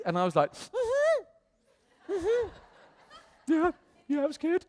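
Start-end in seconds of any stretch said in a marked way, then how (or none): 0.5-2.5 high, tight hums holding back tears; choked-up mm-hmm. Retold or imitated. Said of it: "And started crying". 3.5-4.6 high, strained voice on the edge of tears; choked-up yes. Retold or imitated. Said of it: "And started crying".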